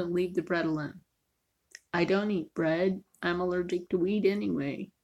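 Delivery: ordinary talk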